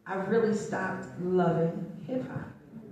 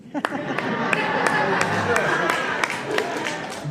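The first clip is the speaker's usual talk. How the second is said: long, breathy laugh; 3 semitones higher, 5 dB louder than usual. Real, not acted. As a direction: laugh it out in puffs. Laughs about the boxer husband.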